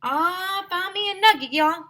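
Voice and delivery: high, loud country holler; shouted. A narrator's reading. Teacher shouting she found a nugget. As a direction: shout the find. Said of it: "suddenly shouted".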